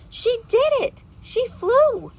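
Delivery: high, loud thrilled cry; excited. Acted. Little robin cheering as his sister flies, excited. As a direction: burst out with joy at the sister's flight.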